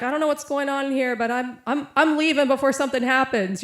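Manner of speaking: retold alarmed exit, high and loud; spooked protest. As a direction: imitate a man anxious to get away. Man retold as spooked, leaving.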